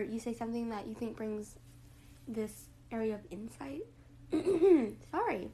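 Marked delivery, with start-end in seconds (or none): 4.1-5.0 loud clearing of the throat. Real, not acted. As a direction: clear your throat hard. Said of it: "getting rid of that morning voice".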